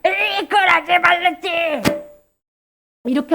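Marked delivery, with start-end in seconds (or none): 0.0-1.8 drawn-out put-on scolding; hammed-up cross telling-off; mock angry outburst. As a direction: act out losing your temper, slowly stretched. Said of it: "막 화내는 거야".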